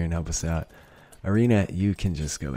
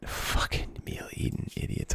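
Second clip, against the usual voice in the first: hushed, whispery grumble; muttered cursing; 10 dB quieter than usual. Real, not acted. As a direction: curse them under your breath. Muttered cursing at pests in game.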